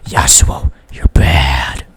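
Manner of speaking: breathy whispered taunt; whispering. Real, not acted. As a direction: whisper it like a secret insult. Aside taunting enemy player.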